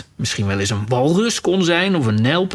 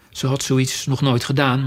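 plain reading voice

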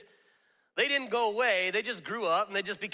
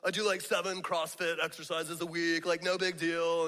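smug gym guy. Retold or imitated. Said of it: "you roll your eyes when he's like".

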